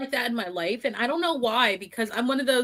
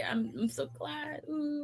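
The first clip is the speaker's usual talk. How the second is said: softer, slow, syrupy fake sympathy; mock sweet; 4 semitones higher, 12 dB quieter, slower than usual. Imitating people who fake concern, syrupy and soft. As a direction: coo it like you don't mean it. People reacting to her problems.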